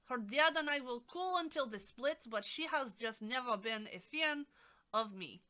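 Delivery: loud amateur Russian accent; exaggerated, not-quite-accurate Russian accent. Shown as a demonstration. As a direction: read it out in a hammed-up Russian accent. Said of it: "All right, we'll try to do Russian".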